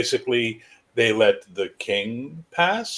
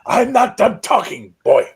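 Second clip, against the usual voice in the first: high, loud rebuke; stern old king; 12 semitones higher, 10 dB louder than usual. Acted. Old king poking the young king, cutting him off sharply. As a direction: snap it at the interrupting boy. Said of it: "starts poking you and goes".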